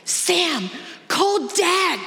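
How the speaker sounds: high-pitched re-enacted shout; acted yell to a child; retold yelling. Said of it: "then I start yelling".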